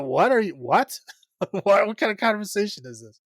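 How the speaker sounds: incredulous, half laughing; bewildered disbelief. Real, not acted. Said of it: "But it's like".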